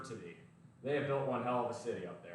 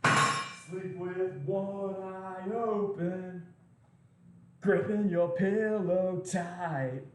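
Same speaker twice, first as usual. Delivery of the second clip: very slow, drawn-out spooky threat; mock ominous warning; 6 semitones higher, 5 dB louder, much slower than usual. To new viewers about his nuclear job, mock menacing slow warning. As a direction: creep them out, word by word.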